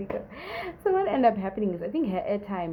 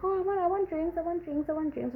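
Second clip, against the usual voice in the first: whiny friend; 11 semitones higher than usual. Retold or imitated. Friend begging for drinks.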